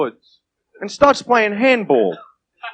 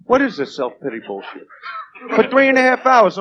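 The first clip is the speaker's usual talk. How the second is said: usual speaking voice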